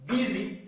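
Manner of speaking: busy dit haut, U comme I; busy en modèle, voix haute; English model word. Shown as a demonstration. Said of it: "mais c'est I". (busy avec I, voix haute)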